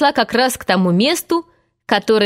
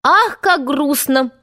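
higher, slow, drawn-out exclamation; cheerful cry; 6 semitones higher, slower than usual. A narrator's reading. Twin laments the parents' sorrow, cheerfully crying out. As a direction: cry "how sad" with cheerful gusto. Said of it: "закричал один из двойняшек бодрым голосом".

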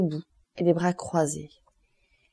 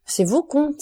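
high and loud cry of glad surprise; joyeuse exclamation; 8 semitones higher, 4 dB louder, slower than usual. A narrator's reading. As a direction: cry out his name, almost joyful. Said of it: "s'écria le jeune homme avec un mouvement qui ressemblait à de la joie". (s'écrier, plus aigu et plus fort, presque joyeux)

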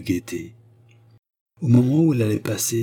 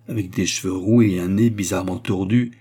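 usual speaking voice

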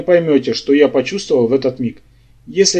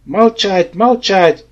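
higher-pitched narrated shout, held back in volume; raised-voice command without full force; 5 semitones higher, much slower than usual. A narrator's reading. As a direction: cry out the order to be silent. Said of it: "заорал я на всю глотку".

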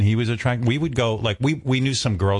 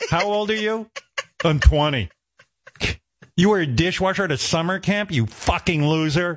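mock girls scoffing; 7 semitones higher than usual. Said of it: "What did you do this summer?".

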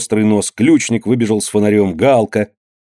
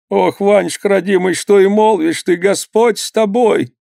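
высокий старушечий голос, с испугом; ахни по-старушечьи, испуганно; испуганная старуха; 9 semitones higher than usual. A narrator's reading. Испуганная мать. (мать пугается слов сына, высоко)

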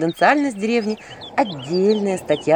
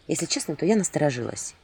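ordinary delivery, nothing marked

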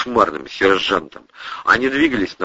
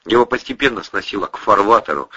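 ordinary delivery, nothing marked

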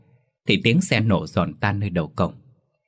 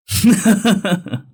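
high loud villain cackle; cackling laugh; 12 semitones higher, 5 dB louder than usual. A narrator's reading. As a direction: cackle in triumph. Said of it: "nó cười lên sằng sặc".

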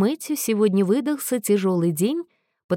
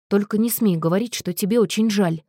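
plain reading voice

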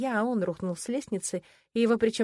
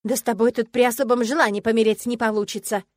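indignant, louder outburst; 3 semitones higher, 8 dB louder than usual. A narrator's reading. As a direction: protest hotly. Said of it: "возмутилась я". (возмущённо)

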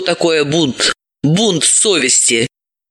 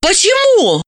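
surprised; 13 semitones higher than usual. A narrator's reading. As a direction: ask in sudden surprise. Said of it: "удивилась Далила".

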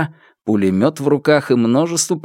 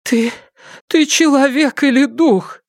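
high, faltering, frightened question; trembling fear; 14 semitones higher than usual. A narrator's reading. Trembling mother facing her returned son-in-law, high frightened voice. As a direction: falter on the first word, then ask high and fearful. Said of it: "Та вся дрожала".

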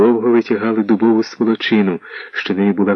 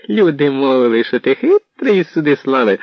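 much higher, mocking amusement; amused outburst; 6 semitones higher, 3 dB louder than usual. A narrator's reading. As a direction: tease him in a high, amused voice. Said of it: "розреготався".

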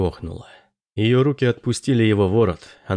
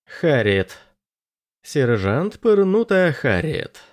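slow, drawn-out proclamation; proclaiming; 5 semitones higher, slower than usual. A narrator's reading. Anders trying out the sergeant's new name, proclaiming it. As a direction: announce the new title slowly, savouring it. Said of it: "провозгласил Андерс".

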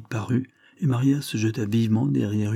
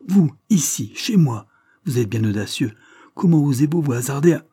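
higher, louder outraged squire, a touch held back; angry squire; 5 semitones higher, 6 dB louder than usual. Acted. Squire confronting the man in his house, outraged. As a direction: bristle with fury at the intruder. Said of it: "blême de colère".

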